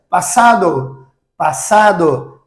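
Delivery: passado with a single sharp s, said slowly; Spanish pronunciation. Shown as a demonstration. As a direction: say passado the Spanish way, clear s sound. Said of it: "só tira os dois S e muda a pronúncia".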